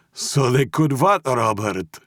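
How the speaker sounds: put-on high, insistent Russian-accented question; pressing Russian boss. A narrator's reading. Mob boss demands rest of confession, insistent.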